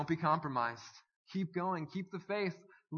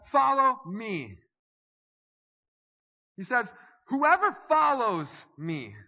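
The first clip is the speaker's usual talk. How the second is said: loud, higher fiery sermon delivery; preaching at full force; 5 semitones higher, 7 dB louder than usual. Acted. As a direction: call sinners to come forward with fire. Altar-call preaching at full force.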